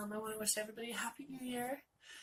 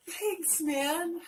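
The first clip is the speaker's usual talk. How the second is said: high, loud sarcastic thanks; 7 semitones higher, 7 dB louder than usual. Retold or imitated. To nurse after backhanded compliment, sarcastic thanks. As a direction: throw back a dry thanks at the backhanded compliment.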